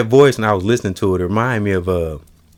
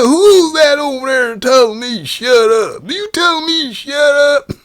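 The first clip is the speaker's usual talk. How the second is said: much higher cartoon cowboy brag; Yosemite Sam; slower than usual. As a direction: crow each word like a cartoon gunslinger.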